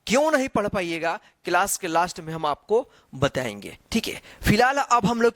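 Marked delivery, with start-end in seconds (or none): none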